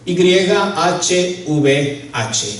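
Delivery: Y H V H, each letter named slowly and apart; slow letter-by-letter spelling; spelled letter by letter. Shown as a demonstration. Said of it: "La Biblia nos dice que su nombre es".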